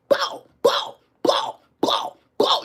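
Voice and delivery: high breathy bow bow; gunshot sounds. Retold or imitated. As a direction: fire off gun noises. Imitating shots.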